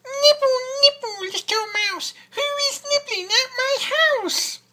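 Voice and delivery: put-on very high, loud, squeaky old witch. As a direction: squeak it out like a greedy fairy-tale witch. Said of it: "a squeaky voice from somewhere says".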